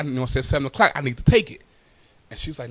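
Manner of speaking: normal talking voice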